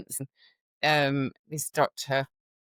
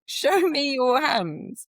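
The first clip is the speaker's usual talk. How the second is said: much higher, slow, louder mocking parrot; mocking echo of doctor; 12 semitones higher, 5 dB louder, much slower than usual. Nurse retelling the doctor mimicking her order, high mocking echo. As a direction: parrot her back like a sneering man. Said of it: "And he went".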